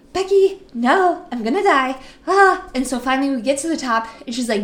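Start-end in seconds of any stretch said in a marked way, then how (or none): 0.1-2.7 higher and louder, ending in a cry; hammed-up panic; mock terror. Narrator at the top of the coaster, screaming in mock terror. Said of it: "And I'm like".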